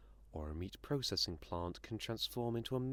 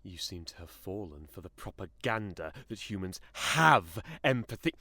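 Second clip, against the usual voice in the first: building from controlled scorn to a loud outburst; indignant anger; 9 dB louder than usual. Acted. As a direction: let the anger swell through the sentence. Creature bristles, building to fury.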